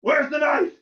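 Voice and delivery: acted interrogation; retold demand. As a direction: demand it like a soldier standing over someone. Soldier confronting him on the bus.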